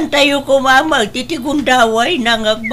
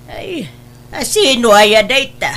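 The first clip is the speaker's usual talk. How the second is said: ordinary delivery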